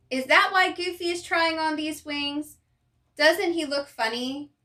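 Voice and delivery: ordinary delivery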